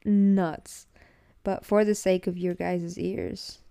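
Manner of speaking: ordinary talk, nothing marked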